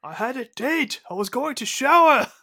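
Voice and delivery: high, indignant mock protest; quoted movie line. Co-host quoting a favorite line for laughs, high mock protest. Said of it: "That line".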